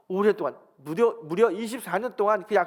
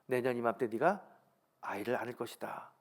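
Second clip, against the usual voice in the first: God's promise, softly; 6 semitones lower, 6 dB quieter than usual. Retold or imitated. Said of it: "이야기합니다".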